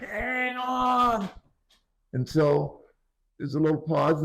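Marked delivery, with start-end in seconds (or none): 0.0-1.3 retold yell. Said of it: "just yelled".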